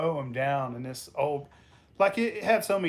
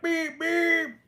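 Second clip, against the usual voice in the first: high "beep beep" horn impression; playful horn toot; imitating a car horn; 13 semitones higher than usual. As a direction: honk like a car with your voice. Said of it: "And I'd be like".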